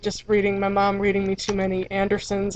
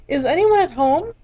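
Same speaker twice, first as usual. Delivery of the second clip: higher, louder butterfly calling; 5 semitones higher, 5 dB louder than usual. Acted. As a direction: knock and call loud into the house. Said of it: "this time quite loudly".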